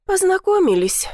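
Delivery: high, tight repeat through clenched teeth; bitterly spitting the word Познакомились out; through gritted teeth. A narrator's reading. Granddaughter answering grandma through gritted teeth. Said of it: "процедила я сквозь зубы".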